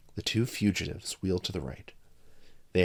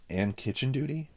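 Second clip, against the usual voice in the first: mock hope; 6 semitones higher than usual. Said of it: "prompted Rudy in mock hope".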